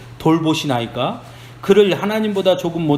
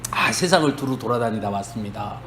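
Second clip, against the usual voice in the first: low, softer voice for a retold Satan; lightly voiced Satan, lower and quieter; 9 semitones lower, 5 dB quieter than usual. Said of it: "그러니까 사단이". (낮고 작은 사단 목소리)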